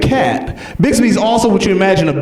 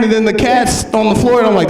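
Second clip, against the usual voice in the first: ordinary delivery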